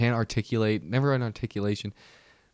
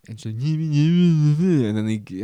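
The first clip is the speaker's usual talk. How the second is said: mock babbling; 5 semitones higher, 6 dB louder, much slower than usual. Garbled mock voice in retold argument.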